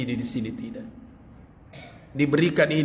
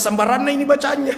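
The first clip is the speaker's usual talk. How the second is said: fast, higher and louder exclamation; excited; 10 semitones higher, 5 dB louder, faster than usual. Retold or imitated. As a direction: blurt it out amused. Exclaims at odd prayer. (seru cepat dan keras)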